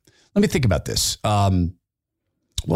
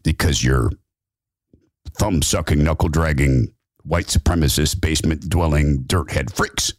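much lower, grave voice breaking the news; deep objector; 8 semitones lower than usual. Acted. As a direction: drop the voice low like a grim heckler. Objector insults the room.

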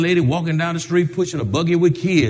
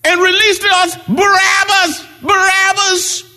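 higher, loud crowd cry; crowd shout; 13 semitones higher, 3 dB louder than usual. Retold or imitated. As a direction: shout it like a mob. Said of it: "They said".

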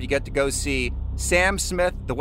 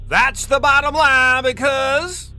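loud, slow, higher wrestler shout; Stone Cold impression; 8 semitones higher, 5 dB louder, slower than usual. Shown as a demonstration. Radio host doing Stone Cold's catchphrase for a quiz, loud slow shout. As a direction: bellow it like a pro wrestler cutting a promo. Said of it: "You don't think she'll be able to do".

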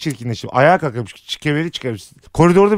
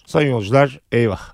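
lower, pilot announcement voice; pilot over the radio; 7 semitones lower than usual. Pretend. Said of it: "Pilottan bir anons".

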